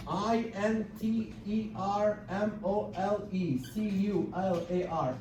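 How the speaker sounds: letters slow and soft; slowly spelled intermolecular. Shown as a demonstration. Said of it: "spelling out the word".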